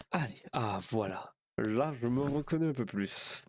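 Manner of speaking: lightly voiced roleplay character. Streamer back in his role, hot and changing clothes, lightly in character. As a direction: slip lightly into character, pitch nudged up. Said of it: "Je retourne dans mon personnage".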